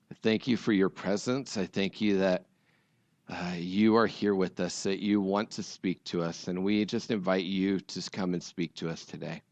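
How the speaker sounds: soft, low, unhurried prayer voice; quiet prayer. Real, not acted. Pastor praying softly before the sermon, hushed and earnest. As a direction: pray gently over the congregation.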